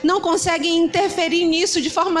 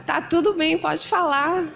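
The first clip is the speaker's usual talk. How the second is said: sudden sweetness; slower than usual. Pretend. Mom switches sweetly to pastor.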